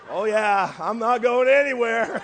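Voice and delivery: loud, laughing oh yeah; laughing eager reply. Retold or imitated. Pastor answering the sweet phone agent, loud and laughing. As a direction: blurt it out with a laugh, eager to stay.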